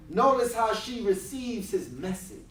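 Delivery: normal talking voice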